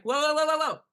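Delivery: high, loud run of whoas; acted urgent braking, words tumbling together; reining oneself in. Speaker stopping their own panicked rush, reining in. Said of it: "Not too fast".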